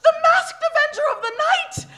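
loud high gasping hero cry; thrilled announcement. Acted. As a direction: hail the hero's arrival breathlessly. Excited hero sighting.

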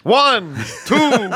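loud, high campy Count counting; vampire count counting. Acted.

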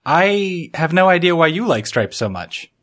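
ordinary talk, nothing marked

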